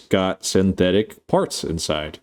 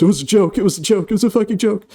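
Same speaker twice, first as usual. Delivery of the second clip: high-pitched, sheepish mock protest; 13 semitones higher, faster than usual. Retelling Bjorn backing down once his collar is grabbed, sheepish. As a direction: backpedal in a much higher, hammed-up sheepish voice. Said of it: "very sheepishly goes".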